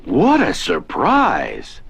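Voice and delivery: usual speaking voice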